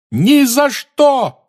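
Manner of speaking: very slow, loud high yell. A narrator's reading. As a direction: bellow the refusal. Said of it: "завопил Гарри". (вопль)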